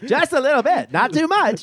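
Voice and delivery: put-on high old-timey pitchman; sly 1920s radio salesman; mid-Atlantic accent.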